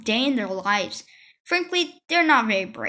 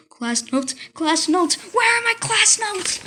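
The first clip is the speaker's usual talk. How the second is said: frantic search; 7 semitones higher, slower than usual. Acted.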